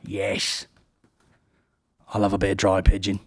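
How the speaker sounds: slow, relishing mock judge; lightly hammed-up food-critic drawl; Greg Wallace impression. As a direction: drag it out like a greedy critic. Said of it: "And he's like".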